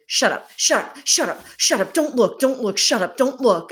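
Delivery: urgent, hammed-up agitated date voice; imitated screaming. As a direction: mimic a panicked order in rapid bursts. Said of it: "like, screaming at me at the table".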